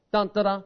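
short sung trumpet call; imitated trumpet notes. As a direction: hum the trumpet tune lightly. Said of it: "that trumpet's gonna go".

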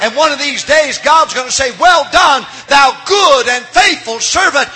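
impassioned revival crescendo, words stretched at the peak; full-force preaching. Acted. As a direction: proclaim it in a rousing pulpit shout.

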